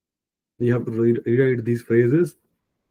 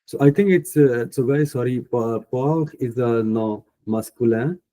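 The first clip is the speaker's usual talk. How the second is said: usual speaking voice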